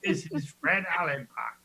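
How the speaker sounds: lightly put-on Cockney accent, a laugh behind it; playful mock Cockney introduction. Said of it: "I sound like Cockney".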